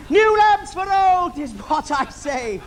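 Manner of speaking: high, loud villain's call; villain calling out his wares; panto villain cry. Acted. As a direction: hawk it like a panto baddie.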